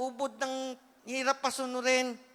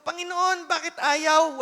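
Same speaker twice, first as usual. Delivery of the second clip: retold complaint; 5 semitones higher, 5 dB louder, slower than usual. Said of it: "Nagrereklamo na ako sa Lord".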